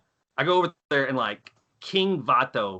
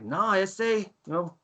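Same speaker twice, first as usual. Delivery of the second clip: higher, hammed-up East LA Chicano gangster accent; 5 semitones higher than usual. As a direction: defend the new homie in a thick East LA accent. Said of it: "you do that East L A accent".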